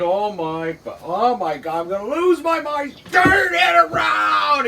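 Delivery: nearly losing his mind, drag it out; exasperated yell. Retold or imitated. Boss at wits' end with nephew, desperate yell. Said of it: "you can hear he's almost crying".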